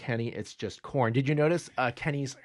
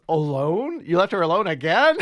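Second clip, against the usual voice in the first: raised, louder incredulous question with a laugh; mock disbelief; 5 semitones higher, 6 dB louder than usual. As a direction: play up the shocked questioning. Retold Kenny's disbelief at news.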